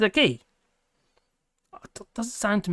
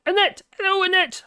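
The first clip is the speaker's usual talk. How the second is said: high, bright call of the name; pitched-up welcome to a donor; excited greeting; 11 semitones higher than usual. Real, not acted. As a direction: greet her like a delighted host. Greeting a super chat donor.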